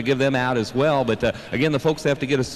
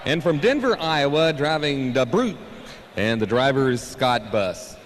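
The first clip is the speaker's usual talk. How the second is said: tractor pull announcer; 2 semitones higher than usual. Acted. Co-announcer reading driver intros.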